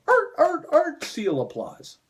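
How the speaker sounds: high barking seal noises; seal applause. Retold or imitated. Mocking the audience as clapping seals with barks.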